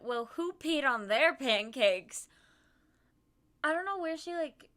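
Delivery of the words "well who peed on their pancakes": slow, louder quip at grumpy people; deadpan it, slowly; mock huffy. Friend mocking grumpy diners, slow and louder. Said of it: "she just went".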